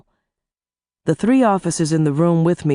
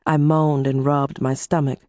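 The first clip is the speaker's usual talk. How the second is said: plain reading voice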